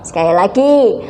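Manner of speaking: ordinary talk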